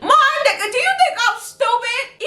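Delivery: high, laughing incredulous challenge; squeaky laughing protest; mock disbelief. Mock disbelief at friend's story.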